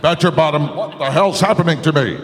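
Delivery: loud, high cartoon panic. Cartoon character panicking for the hosts. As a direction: yelp it out like a toon.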